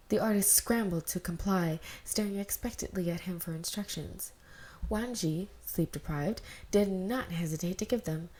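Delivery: ordinary delivery, nothing marked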